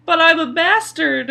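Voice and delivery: imitating a shocked young man; incredulous protest. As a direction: blurt it out as if you can't believe it. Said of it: "And Gendry's like".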